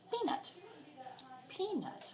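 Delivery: high sing-song call to the cat. Real, not acted. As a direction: call the kitty up high and soft. Calling the cat sweetly.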